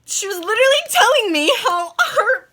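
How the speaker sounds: half laughing through the words; giggling disbelief. Real, not acted. Laughing recounting outrageous story.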